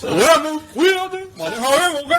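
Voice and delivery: high, lively Cuban neighbor voice; Cuban accent. Pretend. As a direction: greet like the chatty Cuban neighbor Roberto. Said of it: "I'm pretty good at the Cuban accent".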